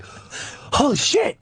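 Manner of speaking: high, drawn-out breath of shock; mock panicked gasp. Father spotting a gunman in the woods, acted gasping shock. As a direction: gasp it out high, nearly voiceless.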